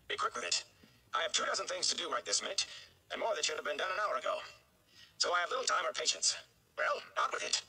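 impatient mayor. Acted. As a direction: hurry the caller along. Busy mayor snaps at visitor.

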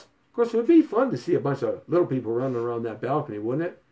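ordinary delivery

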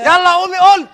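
very loud, urgent command; shouted order. Acted. Exorcist telling them to get up, loud shouted order.

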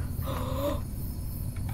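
high, sharp gasp; startled gasp. Real, not acted. Player interrupted by game scare, startled gasp. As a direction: gasp hard, cut off in fright.

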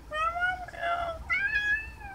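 very high, slow squeaky "I love you"; high cat voice. Shown as a demonstration. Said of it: "Come on".

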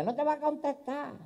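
mock the mourner in a high old voice; grandma mocking lament. Grandma mimics the deaf mourner, very high.

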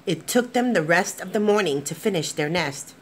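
gentle, expressive storybook narration; lively picture-book reading to kids; reading to children. A narrator's reading. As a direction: read it like bedtime story time. Reads picture book aloud.

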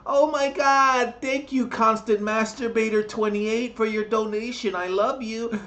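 high girly mockery of a Twitch streamer; mock streamer girl. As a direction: gush like a fake-sweet camgirl. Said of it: "She goes".

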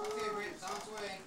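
cashier voice. Pretend. Mock drive-through reply.

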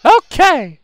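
put-on high, slow child's question; small child. Child asking a friend why her mother died. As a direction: ask innocently like a little kid.

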